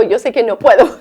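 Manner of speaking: words breaking into a laugh. Real, not acted. Mother admitting she can't discipline angry, laughing. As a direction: laugh at yourself as you admit it.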